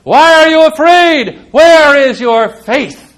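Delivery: high, loud and far-carrying rebuke; rebuking call. Acted. Jesus rebuking the frightened disciples on the boat, loud and slow. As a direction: call out the challenge, then slow it down. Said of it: "and Jesus says".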